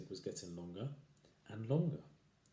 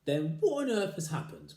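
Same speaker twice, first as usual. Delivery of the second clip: higher, louder anxious cry; crying out wife; 8 semitones higher, 7 dB louder than usual. Acted. Wife crying out about her stick, alarmed. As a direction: wail it out in alarm. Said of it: "cried Missus Twit".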